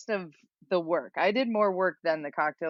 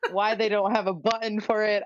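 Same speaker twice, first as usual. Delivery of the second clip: normal talking voice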